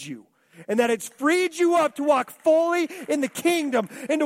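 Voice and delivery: loud, high, driving sermon voice; fiery preaching. Acted. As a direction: preach it full force. Preaching at full force.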